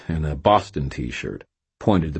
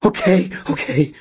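frightened; 13 semitones higher, 4 dB louder than usual. A narrator's reading. Cashier complies in fear.

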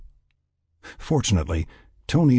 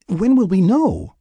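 high, louder, slow question; 13 semitones higher, 5 dB louder, slower than usual. A narrator's reading. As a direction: press slowly and insistently for an answer. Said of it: "Laura questioned".

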